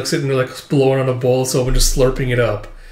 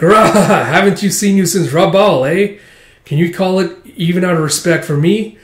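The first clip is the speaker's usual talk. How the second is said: laughing, higher, louder character voice; boisterous captain; 5 semitones higher, 4 dB louder than usual. Acted. Captain greets the yakuza after a meal, boisterous. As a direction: greet them with a big hammy laugh.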